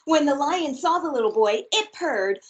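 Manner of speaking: lively, expressive storybook read; reading to children. Acted. Teacher reads a picture book aloud to her class, bright and expressive. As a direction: read it big and playful for little listeners.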